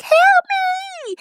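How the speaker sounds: mock scream for help. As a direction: shriek it like she's just found the body. Scarlett finds the stabbed body.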